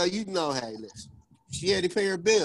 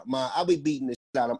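bleeped out; 6 semitones lower, 3 dB quieter, faster than usual. Real, not acted. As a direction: swear word bleeped out. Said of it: "He just got bleeped".

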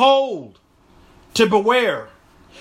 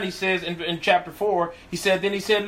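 normal talking voice

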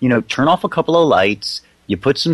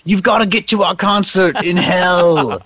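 high, slow, hammed-up rocker cry; doomed rock band; 8 semitones higher, slower than usual. Killed band summoning riders to a concert, hammed-up rocker voice. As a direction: stretch it out like a mock-ghoulish concert call. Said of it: "in the pre-ride they get killed".

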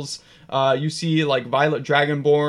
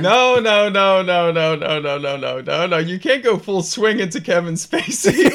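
laughing protest; 3 semitones higher, 3 dB louder than usual. Real, not acted. Game master cuts off a player's over-the-top impression, laughing protest. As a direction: object through real laughter.

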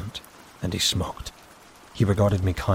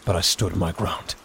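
plain reading voice

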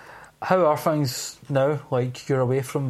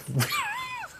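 burst of laughter; 6 dB quieter than usual. Real, not acted. Laughs at crazy neighbours.

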